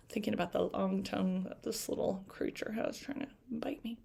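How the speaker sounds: soft, breathy aside; whispered aside. Real, not acted. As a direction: murmur it to the listeners. Aside to listeners.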